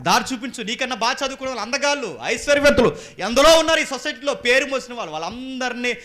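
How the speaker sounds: high, loud scolding from the pulpit; loud rebuke. Real, not acted. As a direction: thunder the rebuke at the listeners. Preacher exhorts loudly. (ఎత్తైన స్వరంతో గట్టిగా మందలింపు)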